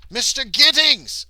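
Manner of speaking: high, loud, drawn-out name call; big hammed-up announcement; mock exclamation. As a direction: cry out the villain's name theatrically. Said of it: "That was the wrong voice for him".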